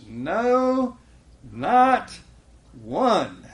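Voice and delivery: high, loud no, not one; emphatic. Acted. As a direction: hammer each word. Emphatic scripture quote.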